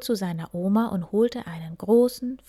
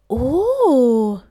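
loud, higher cry of wonder; astonished; 6 semitones higher, 11 dB louder than usual. A narrator's reading. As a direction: gasp out in amazement. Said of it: "staunten sie".

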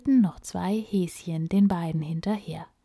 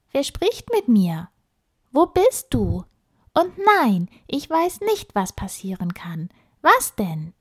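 put-on young girl voice; 5 semitones higher, 4 dB louder than usual. Emma calls to the hidden voice.

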